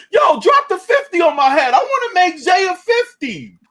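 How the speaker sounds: hammed-up imitation of a hype request; mock hype. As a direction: shout it high like an overexcited fan. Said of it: "talking about".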